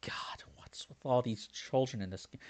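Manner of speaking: whispered aside. Real, not acted. Player grumbling to viewers about all the kids in this game. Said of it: "unvoiced whisper".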